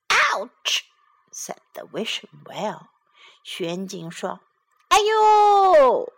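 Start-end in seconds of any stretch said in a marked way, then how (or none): 0.1-0.8 high, sharp cartoon yelp; pained well. The well is hit by a penny. 4.9-6.1 high, loud cartoon yelp; 哎呦痛呼; yelp of pain. The well is hit by a penny.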